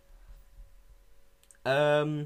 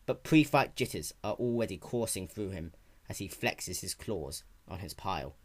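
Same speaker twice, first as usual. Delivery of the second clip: dramatic story narration; 6 semitones lower, 4 dB quieter than usual. A narrator's reading. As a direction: read it like a brooding fantasy tale. Reads his fanfiction aloud.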